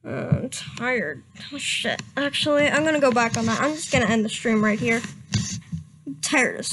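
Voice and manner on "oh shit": ordinary delivery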